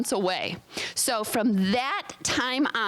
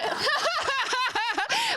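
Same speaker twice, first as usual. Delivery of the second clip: high delighted laugh; cracking up at her own pun; real laugh. Real, not acted. Pastor laughing at her cartoon pun, high real laugh.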